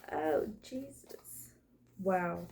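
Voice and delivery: whispered under your breath in awe; hushed, slow gasp of disbelief; awed whisper. Real, not acted. Reacting to a card spread.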